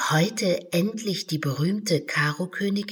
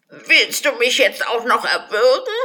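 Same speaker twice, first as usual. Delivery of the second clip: loud, high angry outcry; 5 semitones higher, 7 dB louder than usual. Acted. The unicorn protests angrily at Alice. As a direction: protest being strangled. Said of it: "Das Einhorn war wütend".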